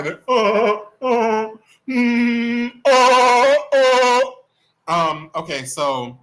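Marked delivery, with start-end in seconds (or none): 0.0-4.2 high, loud imitated wailing cry; Wendy cry. Streamer doing an impression on request, mock crying. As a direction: wail in mock crying bursts. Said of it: "Do Wendy, that cry".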